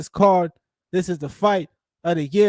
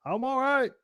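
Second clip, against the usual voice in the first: much higher, soft, breezy mock fighter; mock Volkanovski; 9 semitones higher, 7 dB quieter than usual. Fan imitating the fighter brushing off chokes, breezy and high. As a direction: shrug it off like nothing happened. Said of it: "Volkanovski was just like".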